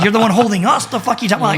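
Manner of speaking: retold exasperated protest at the police; you're the police, what are you talking about.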